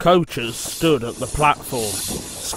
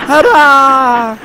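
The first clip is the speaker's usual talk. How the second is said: cheering hurrah; 10 semitones higher, 10 dB louder than usual. Acted. Engine cheering on arrival.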